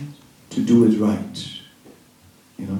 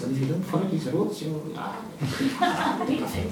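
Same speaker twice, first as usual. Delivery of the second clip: a higher, laughing parody of a rule-bound preacher; mocking strict preacher; 8 semitones higher, 6 dB quieter than usual. To the audience, mocking rule-hammering preachers, a higher parody breaking into laughter. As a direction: send up the scolds who hammer people with rules, laughing.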